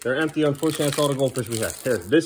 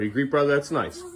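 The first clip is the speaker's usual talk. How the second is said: normal talking voice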